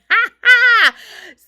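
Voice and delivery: shrieking burst of laughter; loud high laugh. Real, not acted. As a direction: let out a big high laugh.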